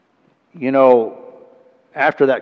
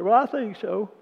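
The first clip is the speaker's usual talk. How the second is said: high, choked-up answer; tearful high answer; banker's reply; 11 semitones higher than usual. Retold or imitated.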